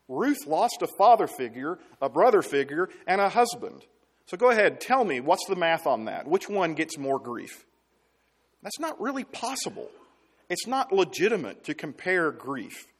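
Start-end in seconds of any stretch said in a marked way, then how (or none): 4.2-7.7 louder and higher, daring them; challenging. Real, not acted. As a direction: go ahead, dare them to do the math. Challenging congregation about comparing grief.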